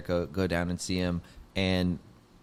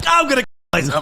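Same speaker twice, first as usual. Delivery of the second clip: mock despair; 9 dB louder, much faster than usual. Retold embarrassment at Norm.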